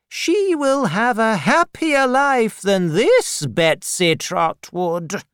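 put-on high, slow, imperious old lady voice; a severe aunt demanding at the door; stern old aunt. Aunt Betsy questions the frightened widow, high and slow.

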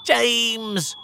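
high, loud, drawn-out call; desperate cry. A narrator's reading. Earthworm calls James as they fall, desperate cry. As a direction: call the name out in alarm. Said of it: "cried the earthworm".

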